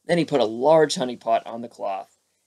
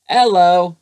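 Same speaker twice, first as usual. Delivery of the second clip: loud hello; 6 semitones higher, 12 dB louder than usual. A narrator's reading. Pooh greets Eeyore.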